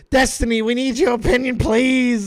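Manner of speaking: loud, mock-desperate begging; mock pleading. Caller begging a streamer for his opinion, mock plea. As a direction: imitate a needy fan begging.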